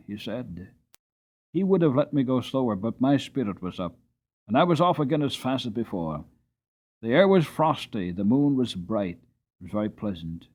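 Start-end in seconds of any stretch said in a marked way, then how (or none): none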